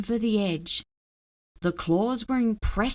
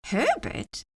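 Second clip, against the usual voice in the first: surprised; 9 semitones higher than usual. A narrator's reading. Child surprised at name.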